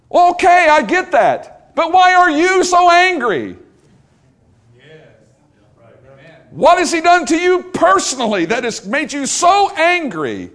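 pressing, exasperated challenge; exasperated shout. Retold or imitated. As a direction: demand an answer, fed up. Preacher presses the angry man.

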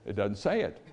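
small, hesitant reply; meek student. Retold or imitated. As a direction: offer it quietly and timidly. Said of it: "one little meek guy".

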